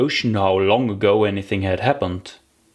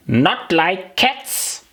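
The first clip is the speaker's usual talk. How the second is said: a touch higher, slow indignant question; a mild shrill outburst; shrill passionate; 7 semitones higher, much slower than usual. Acted. The mouse to Alice, mildly shrill and indignant. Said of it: "in a shrill, passionate voice".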